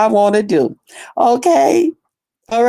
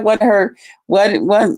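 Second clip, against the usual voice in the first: usual speaking voice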